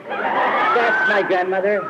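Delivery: slow, loud, high-pitched protest; indignant friend. Retold or imitated. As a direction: draw it out, offended, defending grandma. Friend indignant, grandmother insulted.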